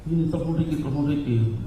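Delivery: whisper-soft urgent plea to call Ricky; hint of a frightened mother; trembling mother. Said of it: "gemeteran tangannya ibu".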